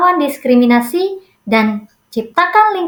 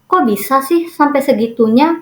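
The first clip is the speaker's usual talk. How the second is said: usual speaking voice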